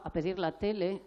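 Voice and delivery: ordinary talk, nothing marked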